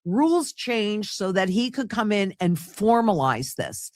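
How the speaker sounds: ordinary delivery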